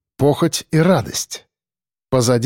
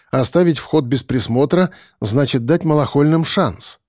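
usual speaking voice

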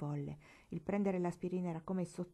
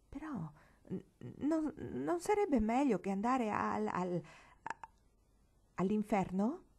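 halting, with pauses before the dreaded word; hesitant stammer; 3 semitones higher than usual. A narrator's reading. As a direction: falter and restart before daring to ask. Afraid to name hell. (esitante, balbettando)

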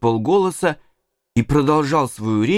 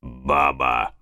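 scornful deep villain; 11 semitones lower, 4 dB quieter than usual. A narrator's reading. Injun Joe scorns partner.

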